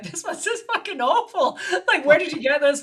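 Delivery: high, incredulous laughing complaint; laughing retold scorn. Her critiquing scripts to the screenwriter, high and laughingly scornful. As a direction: trash it bluntly through laughter.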